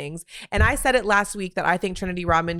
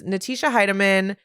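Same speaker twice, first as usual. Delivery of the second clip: usual speaking voice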